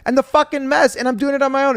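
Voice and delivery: high, loud mock rant of a fed-up mother; imitating mom griping about the mess, high and loud; exasperated mom. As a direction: vent like a worn-out single mom. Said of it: "So my mom would be like".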